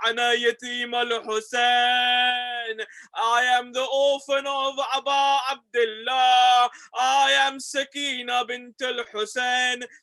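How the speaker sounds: preaching at full force. Acted. Sakina tells the narrator her complaints to her grandfather, in an impassioned, building preaching lament. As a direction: build the grief step by step until it breaks into shouts.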